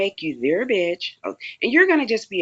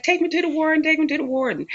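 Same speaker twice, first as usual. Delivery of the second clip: crying plea; 10 semitones higher, faster than usual. Retold or imitated. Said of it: "she would start crying".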